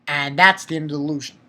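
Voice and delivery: ordinary delivery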